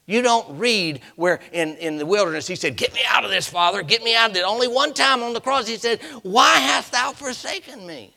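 high, loud pulpit cry; impassioned preacher in full cry; full-force preaching. Real, not acted.

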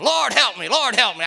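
high, loud crying out; desperate plea. Retold or imitated. Woman bursting in at the king's feet, a loud desperate plea. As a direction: beg at his feet with everything. Woman begging, quoted by church folk.

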